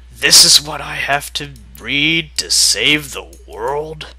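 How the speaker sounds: normal talking voice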